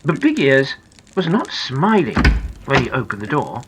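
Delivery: ordinary delivery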